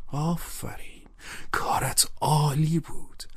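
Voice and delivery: near-whispered inner applause, drawn out; to himself. A narrator's reading. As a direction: murmur admiring praise privately. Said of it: "عمیقاً به فکر فرو رفتم و با خودم گفتم".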